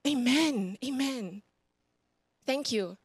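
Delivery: ordinary delivery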